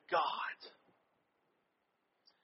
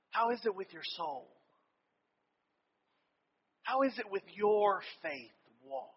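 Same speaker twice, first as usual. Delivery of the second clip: gentle question; 9 dB quieter than usual. Retold or imitated. Mentor patiently repeating his question to the student, soft and gentle. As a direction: ask it softly and patiently, smiling. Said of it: "he would smile. And he would say".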